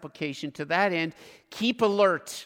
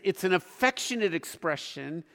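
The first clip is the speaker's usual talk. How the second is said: ordinary delivery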